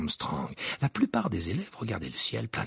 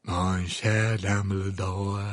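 slow, lower grand lady voice; deep voice; 3 semitones lower, much slower than usual. Acted. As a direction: answer in a low, slow rumble. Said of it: "d'une voix grave".

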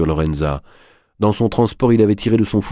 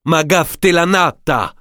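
insulte criée; 11 semitones higher, 3 dB louder, slower than usual. Acted. Insulte en sicilien.

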